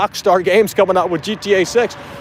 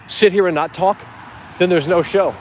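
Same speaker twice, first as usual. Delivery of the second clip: ordinary delivery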